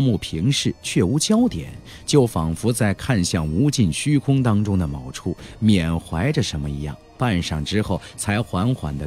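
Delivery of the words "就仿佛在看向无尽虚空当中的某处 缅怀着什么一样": ordinary delivery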